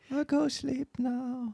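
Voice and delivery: very slow, very high drawn-out voice; dim-witted cat. Retold or imitated. Brainless cat answering why mouth hangs open, dim high voice. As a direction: stretch it out as a vacant mouth-breather.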